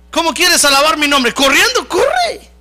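high shouting, building to drawn-out cries; preaching at full force. Real, not acted. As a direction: rouse the congregation into shouted praise. (arenga a gritos, cada vez más aguda)

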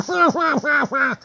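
high pulsing mock laugh; Laughing Sal cackle. Woman delighted after her ride, high Laughing Sal laugh. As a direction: imitate her gleeful cackle. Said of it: "she's laughing like Laughing Sal".

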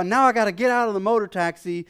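ordinary delivery

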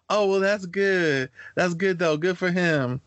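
high, drawn-out mock counselor voice; imitating amused counselor. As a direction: play an approving counselor, pitched well up. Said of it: "she's busting up laughing. She's like".

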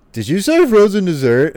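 suddenly interested, high and loud; eager cartoon voice. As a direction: jump on the words as if you can't wait for a treat. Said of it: "And then he's like".

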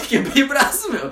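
repete rindo, a voz mais aguda. Real, not acted. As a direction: solta entre risadas o braço quebrado. Rindo ao contar. (rindo alto e agudo)

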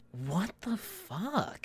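slow, hushed disbelief; stunned whisper. Retold or imitated. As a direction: mouth it in slow shock. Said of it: "Bree is just like".

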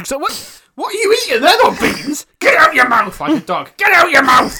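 high, frantic yell at a dog; loud, high-pitched panicked telling-off; mock dog-scolding shout. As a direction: re-enact shouting at a dog with its mouth full. Re-enacts scolding dog eating something.

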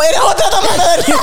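high, laughing protest; laughing retort. Retold or imitated. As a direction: insist through a laugh. Su propia réplica, riendo. (réplica entre risas)

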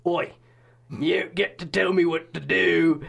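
put-on slow Australian accent, like Russell Crowe; mock Australian accent. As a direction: imitate Jim in an Australian accent. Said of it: "Is this Russell Crowe".

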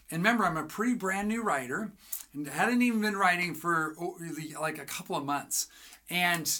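ordinary talk

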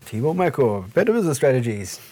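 fast, hyped ad announcer; infomercial voice. Pretend. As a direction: sell it like a TV ad. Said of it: "it sounds like an infomercial".

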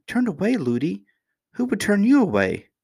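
very high, disbelieving questions; astonished child. A narrator's reading. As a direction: question it like an amazed little princess. Princess astonished at nurse's fear.

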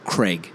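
CRAIG as short "Creg"; as if spelt Cregg. Shown as a demonstration. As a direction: Craig rhyming with "leg". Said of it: "C R E G G".

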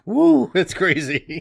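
mock excitement. Co-host mock-excited about two episodes.

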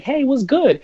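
casual doctor voice. Retold or imitated.

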